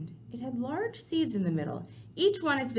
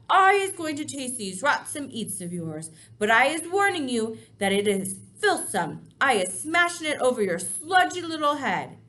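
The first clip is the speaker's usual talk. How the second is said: threatening giant; 4 semitones higher, 7 dB louder than usual. Acted.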